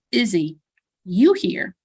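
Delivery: ordinary delivery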